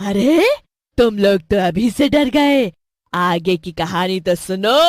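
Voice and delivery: loud storybook witch taunt; mocking witch, projected voice. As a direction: tease frightened captives as a wicked witch. Witch taunts frightened children.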